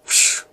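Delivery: short voiceless whoosh; imitated teleport whoosh. A narrator's reading. Elliot teleports into the road, whooshing sound. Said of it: "teleports in the middle of the road".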